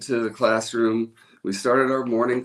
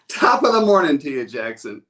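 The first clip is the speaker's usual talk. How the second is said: louder, higher, a hearty call to the crowd; loud cheerful greeting; 3 semitones higher, 7 dB louder than usual. Shown as a demonstration. Host calling to his livestream viewers, loud and cheery. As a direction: belt it out like a morning toast. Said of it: "Say it".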